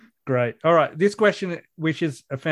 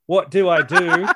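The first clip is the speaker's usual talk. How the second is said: laughing; 4 semitones higher, 4 dB louder than usual. Retold or imitated. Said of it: "I just typed in".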